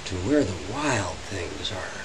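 ordinary delivery, nothing marked

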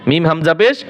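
usual speaking voice

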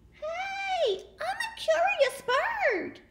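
high, slow, innocent voice; sweet duckling. Acted. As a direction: play it cute and curious.

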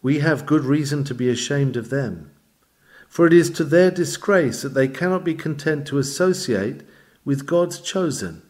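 ordinary talk, nothing marked

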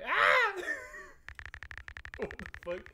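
a high, loud shriek breaking into a laugh; startled scream. Real, not acted. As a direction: jump out of your skin and laugh. Startled by something on screen.

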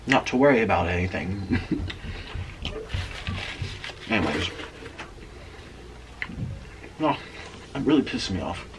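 chewing while talking; muffled through food; mouth full of pizza. Real, not acted. Seen 3: Bites pizza at 4.3, then talks with bulging, chewing cheeks.

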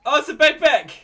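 surprised exclaim. Real, not acted. Viewer spotting the prop on screen, loud surprised exclaim. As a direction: burst out as you spot it. Reacting to a reveal.

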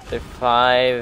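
ordinary talk, nothing marked

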